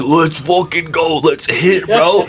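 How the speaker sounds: lit, hyped up. Retold or imitated. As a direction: retell the hype of a winning streak. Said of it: "I was lit like this".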